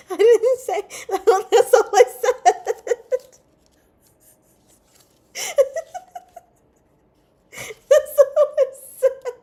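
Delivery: helpless giggling protest; laughing. Real, not acted. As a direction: protest while cracking up. Protesting chat, laughing hard.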